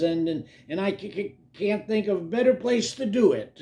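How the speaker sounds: stutter, then push through bravely; halting, eager stammer; nervous stammer. Acted. Nervous character makes himself brave.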